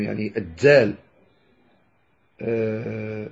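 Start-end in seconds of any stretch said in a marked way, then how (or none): 0.4-0.9 الدال pronounced "ad-dzal", d blended with z; a buzzing dz opening the main syllable of الدال; Sous pronunciation. Shown as a demonstration. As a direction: turn the d in الدال into dz. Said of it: "مشمومة بالتاء أظن".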